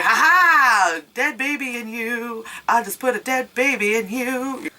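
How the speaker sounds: a lightly voiced taunting child; creepy ghost kid. The ghost boy taunts the heroine, a light creepy sing-song.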